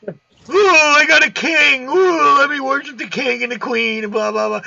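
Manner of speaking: high-pitched gushing mock adoration; mock fawning in a high voice; mocking royal worship. Mocking king-worshipping nations.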